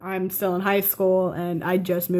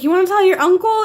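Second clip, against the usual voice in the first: higher, louder, fast; eager aunt; 9 semitones higher, 7 dB louder, faster than usual. Retold or imitated. Aunt pushing her to tell her uncle, eager and quick. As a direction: rush the question out eagerly. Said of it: "she was like".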